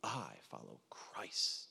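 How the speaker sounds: very slow, low, hushed piety; mock-holy faction member. Corinthian outdoing the others in piety, mock-holy. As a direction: intone it softly, drawing out every word. Voicing the most pious faction.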